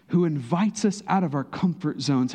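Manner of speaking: ordinary talk, nothing marked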